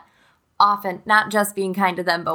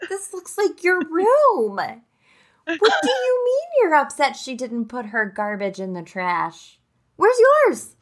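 mock incredulous mom; 8 semitones higher, slower than usual. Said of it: "I'm reading this book, like, peeping over it".

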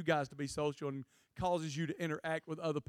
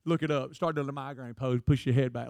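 normal talking voice

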